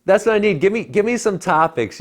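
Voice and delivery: bursting out, caught on the spot; eager laughing plea for topics; excited. Real, not acted. Put on the spot by host.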